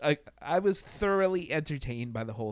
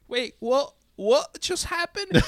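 slow, much higher dazed questions; mock-baffled character voice, higher and louder; confused disbelief; 12 semitones higher, 6 dB louder, slower than usual. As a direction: sound like you just woke up somewhere strange. Mimics the character blinking out.

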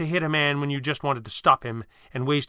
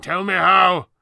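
higher and louder demand; fuming demand; 5 semitones higher, 10 dB louder, slower than usual. Acted. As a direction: bark it at him. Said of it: "Thatcher fumed".

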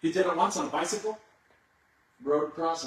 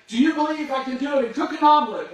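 loud, high call across the falls; yelling to the crowd; 10 semitones higher, 9 dB louder than usual. Retold or imitated. Tightrope walker shouting to the crowd below, loud and high. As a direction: shout it so thousands can hear. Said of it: "He would say".

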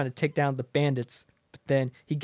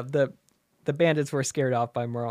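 ordinary talk

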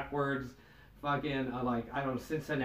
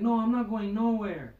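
higher-pitched mock defiance; mild mock yell, pitched up; retold yelling; 6 semitones higher, 4 dB louder than usual. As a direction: imitate her refusing in a fight. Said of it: "this chick was yelling".

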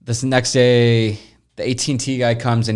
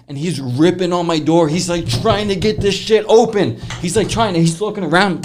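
high-pitched, worked-up retelling; animated storytelling; 8 semitones higher than usual. Real, not acted. Friend recounting a man forcing his door, worked up. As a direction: tell it with rising alarm, pitched well up.

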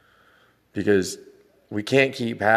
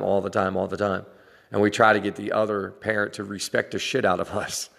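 ordinary talk

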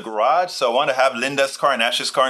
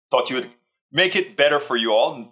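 ordinary talk, nothing marked

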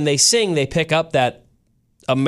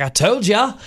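higher-pitched Aussie "told ya"; Australian told-you-so; 7 semitones higher than usual. Retold or imitated. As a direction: toss it off in an Australian accent. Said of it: "then he's like".

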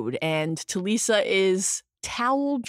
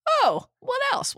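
lightly voiced curious [name].

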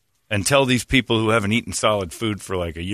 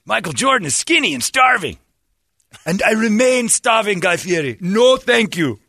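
high, loud caricature voice; mock villager voice; 12 semitones higher, 5 dB louder than usual. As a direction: play a proud starving villager. Voicing starving villagers.

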